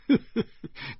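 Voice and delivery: short breathy burst of laughter; snorted laugh. A narrator's reading. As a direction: let a quick laugh burst out. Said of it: "扑哧一声笑出来了". (扑哧一声笑出来)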